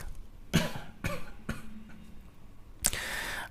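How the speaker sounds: dry real cough. Real, not acted. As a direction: cough through a sore throat. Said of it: "sorry for these coughs".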